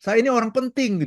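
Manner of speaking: high, indignant self-importance; mock outraged "I'm important"; retold angry boast. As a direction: puff up like an offended boss. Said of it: "dia marah sekali dan dia memaki".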